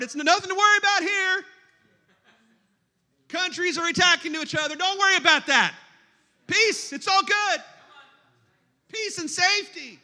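high, airy voice of blithe denial; sarcastically carefree, pitched high; mock reassurance. Mocking the world's denial.

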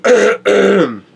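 cracking high voice breaks. Real, not acted. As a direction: let the voice crack on it. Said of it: "My voice is breaking up already".

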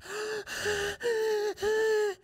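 high, wheezy gulps of air; acted out-of-breath gasps; winded gasping. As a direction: pant hard as if just finishing a run. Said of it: "I finish".

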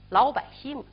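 ordinary delivery

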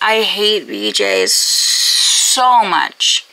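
slow, low, seething complaint; odio contenido. Real, not acted. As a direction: drag out each word in loathing. Venting hatred of character.

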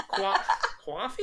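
slow laughing try at Coffee as kwaffee; mimicked Boston coffee. Copying friend's Boston coffee, slow and laughing. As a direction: stumble into kwaffee, laughing at yourself. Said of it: "Is that what you say?".

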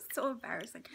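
soft whisper; hushed aside. Real, not acted. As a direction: breathe it out, embarrassed. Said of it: "unvoiced whisper".

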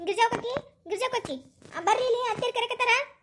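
high summoning call. Acted. Telling Girja that mother-in-law wants her, calling out.

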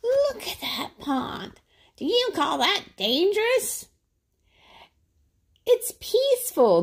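high tempting Blue Jay voice; coaxing jay. Acted. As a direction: lure him toward the pond. Blue Jay persuading Adam.

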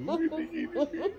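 put-on high, laughing Indian accent. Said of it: "They do this in their head".

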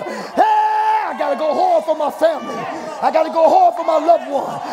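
loud, high, desperate preaching. Real, not acted. Preacher pleads for unsaved family, full-force shouted preaching. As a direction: cry out hard for loved ones.

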